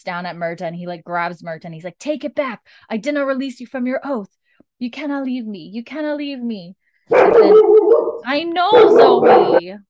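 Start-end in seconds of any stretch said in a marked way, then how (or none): 2.0-6.8 higher, desperate pleading; grieving plea. Retold or imitated. Jamie grieving over Murta. 7.1-9.8 high, loud cry of frustration; venting at someone walking by; sudden outburst. Real, not acted. Shouting at interruption.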